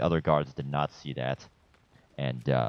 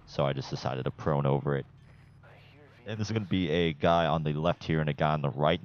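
ordinary talk, nothing marked

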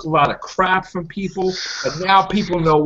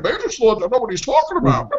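mocking fans; 5 semitones higher, faster than usual. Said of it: "everybody's like".

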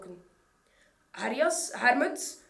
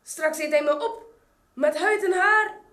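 higher and louder, rising into panic; frightened grandmother; 5 semitones higher, 10 dB louder than usual. A narrator's reading. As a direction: cry it out like a terrified old woman. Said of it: "De arme Grootmoe schrok zich naar".

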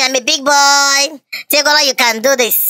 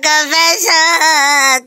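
whining; much slower than usual. Acted. Child whining confession to mother.

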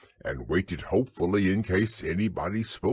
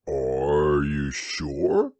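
drawn out gloomy question; very slow doubtful Eeyore; 6 semitones lower, much slower than usual. A narrator's reading. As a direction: doubt every word slowly.

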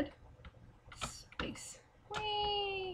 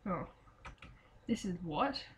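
ordinary talk

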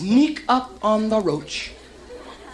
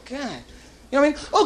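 usual speaking voice

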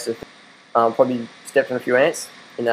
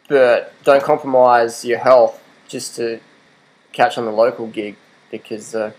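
ordinary delivery

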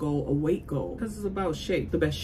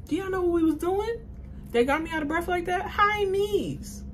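exasperated; 12 semitones higher than usual. Real, not acted. Exasperated complaint about workout.

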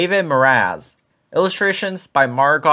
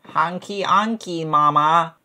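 little goose voice; 2 semitones higher, 3 dB quieter than usual. A narrator's reading. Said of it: "in his little goose voice".

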